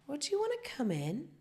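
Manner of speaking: high, rising come-on; coy invitation. Retold or imitated. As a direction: invite him in with a lilting high question. Acted invitation to delivery boy.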